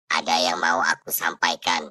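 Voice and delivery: low, hollow ghost voice. Acted. Dead husband's ghost at the door, low ghostly voice. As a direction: speak from beyond the grave, low. Ghost speaks to his wife.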